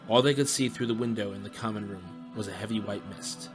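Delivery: ordinary delivery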